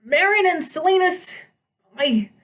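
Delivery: put-on high, nervous old satyr; a shaky brave front; panicky satyr. A narrator's reading. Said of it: "hear the panic in his voice".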